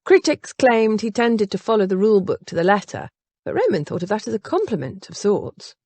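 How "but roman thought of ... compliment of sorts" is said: usual speaking voice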